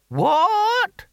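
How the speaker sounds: extremely high, squawked question; very high querulous old voice; deaf old barbarian. A narrator's reading. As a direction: screech it as someone who heard nothing.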